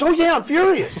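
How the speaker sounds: very high, loud mock fury; acted high-pitched angry outburst; imitating furious woman. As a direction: blurt it out in hammed-up anger. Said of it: "she sounded mad because she was mad".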